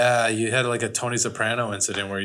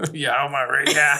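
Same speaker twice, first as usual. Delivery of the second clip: slow, louder mock Austrian thug; 4 semitones higher, 5 dB louder, slower than usual. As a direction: parrot the bad Austrian thug back, slowly. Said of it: "What the fuck was that?".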